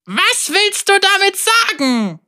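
hoch, argwöhnisch fragend; misstrauischer Bösewicht. Acted. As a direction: skeptisch nachhaken. Vega suspicious. (misstrauische Zauberer-Stimme)

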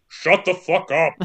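grand, stern British actor impression; Christopher Lee. Podcaster as the judge silencing the court, imperious impression. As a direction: do a commanding old film star, half laughing. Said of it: "Except it's Christopher Lee".

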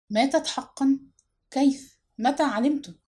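plain reading voice

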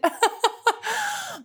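high, self-mocking laugh; a bright embarrassed giggle. Real, not acted. Laughing at her own weak Irish accent. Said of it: "It might not sound like I did".

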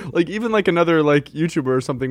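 ordinary talk

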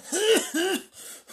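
loud, high hacking smoker's cough; mock coughing fit. Smoker waking next morning, hacking loudly.

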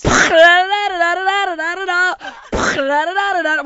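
loud rapid da-da-da burst; fireworks barrage. Retold or imitated. Describing the fireworks show, loud rapid da-da-da. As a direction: rattle it off like a barrage.

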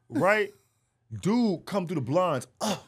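ordinary talk, nothing marked

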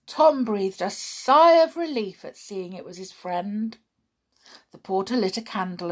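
usual speaking voice

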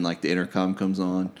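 usual speaking voice

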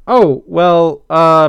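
loud, halting filler words; dazed hesitation. A narrator's reading. Skunk stalls when asked what happened, dazed hesitation. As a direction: stall awkwardly between each word. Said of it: "Pepper said in a stupor".

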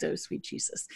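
whispered under the breath; awed whisper. Real, not acted. Reacting to the whisky notes, an awed whisper. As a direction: breathe it out in awe.

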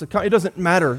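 normal talking voice

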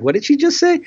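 high, mock-incredulous aside; whisper-turn to the wife in mock shock; retold disbelief. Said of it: "I'm turning to my wife and I'm like".

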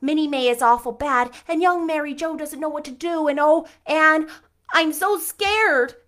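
high, loud frightened pleading; imploring nervously. Acted. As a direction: beg for help, breathless with fear. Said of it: "implored Diana nervously".

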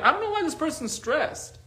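high-pitched mock bewilderment; clueless puzzlement. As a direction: imitate someone obliviously baffled. Mocks someone oblivious to their own stress.

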